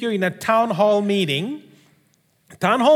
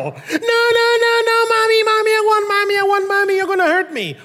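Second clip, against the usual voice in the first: put-on high, loud child's panicked cry for Mommy; yelling child; 11 semitones higher, 4 dB louder than usual. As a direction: scream for Mommy like a scared boy. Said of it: "He's gonna yell".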